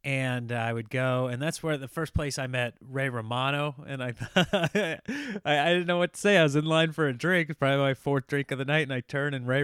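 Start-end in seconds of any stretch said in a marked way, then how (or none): none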